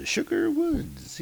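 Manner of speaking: very high, soft, drawn-out words; squeaky echo. Acted. Narrator echoing the place name, high soft squeak. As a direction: coo it in a tiny high voice.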